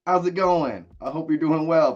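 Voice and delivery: high-pitched attempt at an Aussie accent; terrible Australian accent. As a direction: greet them in a badly faked Australian twang. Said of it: "that was my Australian accent, or trying to be Australian. It was terrible".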